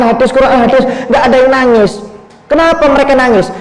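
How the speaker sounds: high-pitched protest to his father; bewildered son. Retold or imitated. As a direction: voice a confused son demanding an explanation.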